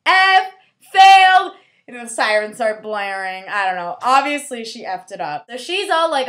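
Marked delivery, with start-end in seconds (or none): 0.1-1.6 high, drawn-out booming shout; hammed-up yelled failing grade; shouted verdict. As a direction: proclaim it slowly at full volume. Acting out testers' failing verdict.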